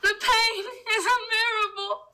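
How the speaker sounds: high, strained dying victim; mock agony. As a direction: wail it like a hammed-up plague victim. Plague victim acting out agony.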